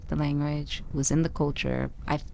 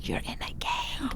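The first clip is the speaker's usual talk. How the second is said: hushed, urgent whisper; 6 semitones higher, 9 dB quieter, faster than usual. Retold or imitated. Friend whispering a warning during the fight, whispered. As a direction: warn her under your breath.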